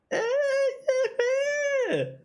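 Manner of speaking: high-pitched squealing laugh at her own joke. Real, not acted. Laughing at her own tree pun, squealing laugh.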